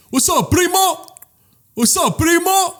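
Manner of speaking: high, drawn-out imitation of an excited fan; hyped fan greeting. Imitating fans coming up to him, hyped greeting. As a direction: call out to your idol in a put-on high voice.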